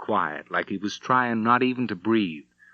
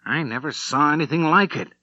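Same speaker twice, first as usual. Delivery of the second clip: dazed amazement; 6 semitones higher than usual. Acted. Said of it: "He seemed a bit dazed".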